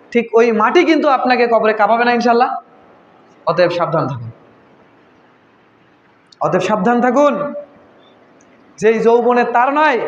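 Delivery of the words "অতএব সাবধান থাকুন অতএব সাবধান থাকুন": low-pitched, falling warning; grave warning. Real, not acted. Preacher cautioning the congregation about the grave, grave warning. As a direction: drop the voice low to warn them.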